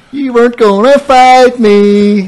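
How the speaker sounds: slow, loud, high sing-out; mocking taunt. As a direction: drag it out loudly like a playground jeer. Acting out his taunt while leaving.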